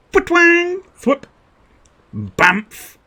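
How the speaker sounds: high, punchy cartoon sound effects; mouth sound effects. Shown as a demonstration. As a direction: spit out comic-book noises. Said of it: "X-Men films".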